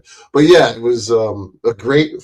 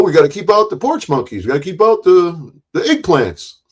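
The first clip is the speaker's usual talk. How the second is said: movie character voice; 8 semitones higher than usual. Retold or imitated. Quoting movie scene.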